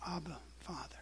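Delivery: very soft, low address; hushed prayer. Retold or imitated. Jesus calling on his Father, very hushed prayer. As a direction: barely voice the name, reverently. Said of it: "Look who he's talking to".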